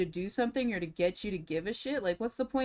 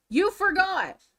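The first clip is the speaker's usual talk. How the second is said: high, loud accusing snap; mock-furious you forgot; angry retort; 10 semitones higher, 7 dB louder, slower than usual. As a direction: throw it back at him hard.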